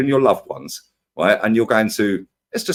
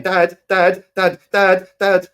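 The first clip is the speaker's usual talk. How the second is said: higher, mock kids' calling out; kids calling dad; 7 semitones higher, much slower than usual. Tired kids nagging their dad at dawn, high calls. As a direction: pull at dad's sleeve with your voice. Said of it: "screaming and shouting".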